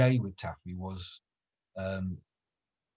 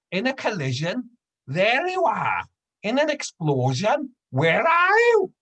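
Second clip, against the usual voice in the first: put-on strong sing-song Welsh old lady, high and rising to a loud slow peak; Welsh old woman; 12 semitones higher, 7 dB louder than usual. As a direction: deliver the punchline in a broad Welsh lilt. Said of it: "Very Welsh".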